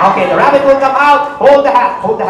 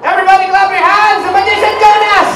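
high, sustained showman's call; stage announcer's raised cheer; rallying the crowd; 7 semitones higher, 3 dB louder than usual. Real, not acted. Rallies audience applause for child.

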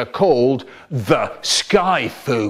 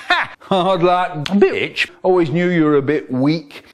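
gloating. Acted. Gloating over the inspector.

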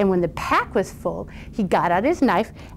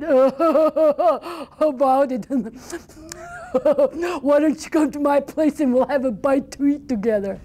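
sly chuckling troll; 5 semitones higher than usual. Acted. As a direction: lure him in with a chuckle. Sly troll invites boy.

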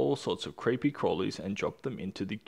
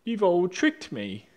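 higher, louder, drawn-out wail; storybook howl of outrage at being tricked; 8 semitones higher, 5 dB louder, slower than usual. A narrator's reading. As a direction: howl it out slowly, high and loud. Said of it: "he howled".

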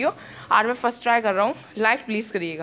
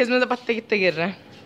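normal talking voice

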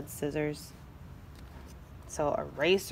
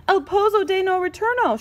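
high, raised cry in Spanish; shouted; 10 semitones higher, 4 dB louder than usual. A narrator's reading. Chinchetta names the dreaded pit, shouting. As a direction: call it out high, with alarm. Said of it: "shouted Chinchetta".

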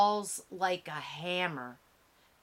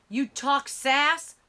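higher, louder sneering challenge; angry taunt; 7 semitones higher, 6 dB louder than usual. A narrator's reading. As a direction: taunt him between blows. Man beating Emmett.

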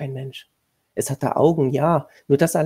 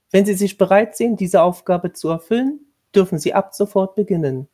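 higher, louder, formal commanding tone; stern lady of the house; 5 semitones higher, 7 dB louder than usual. A narrator's reading. Lady of the house instructing the new governess, stern and formal. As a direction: lay down the duties like a strict mistress.

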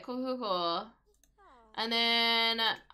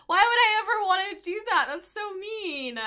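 mock outrage; 8 semitones higher, 6 dB louder than usual. Real, not acted. Outraged at game text.

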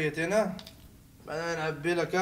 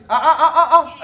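mock cries; 5 semitones higher, 8 dB louder, faster than usual. Exaggerated cries.

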